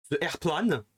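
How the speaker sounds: "The airplane" said "ze airplane" in a French accent; French "ze airplane", flat French vowels. Shown as a demonstration. Imitating French speakers saying "The airplane" the French way. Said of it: "instead of saying the".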